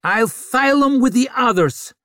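loud, high snarl. A narrator's reading. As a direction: snarl it back. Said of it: "snarled Vic".

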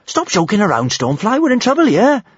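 high, rising alarm; urgent plea. Acted. Panicked plea to amnesiac dragon.

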